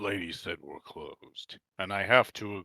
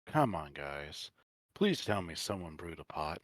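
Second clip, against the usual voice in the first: ordinary talk, nothing marked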